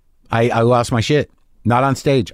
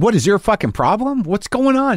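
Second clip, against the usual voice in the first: agitated confrontation; 10 semitones higher than usual. Retold or imitated. Walked up to him in the hallway, agitated. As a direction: confront him, voice riding up high.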